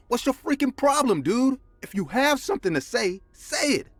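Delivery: high, furious yelling at caller; angry shout. Retold or imitated. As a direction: scream down the phone, fed up. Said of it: "shouted out loud".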